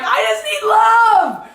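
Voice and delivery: high, drawn-out wailing shout; slow, high, full-out plea; crying out for love. Retold or imitated. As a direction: voice a crowd's desperate cry. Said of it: "all your energy was like".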